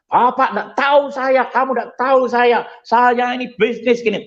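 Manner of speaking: an angry traveller yelling at the airline staff over the delay; angry passenger. Retold or imitated. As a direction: imitate a furious man banging the table.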